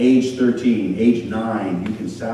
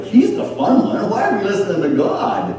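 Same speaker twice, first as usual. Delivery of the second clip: mock tempted person; 9 semitones higher, 3 dB louder than usual.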